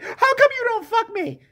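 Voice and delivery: hammed-up high demanding whine; mock needy wife. Wife demands sex from husband, high mock whine.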